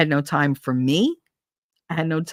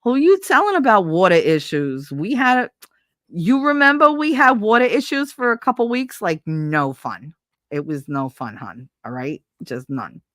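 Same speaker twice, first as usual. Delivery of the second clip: put-on mild Brooklyn accent. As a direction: play a Brooklyn local complaining, lightly. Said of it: "So this is you Brooklynized".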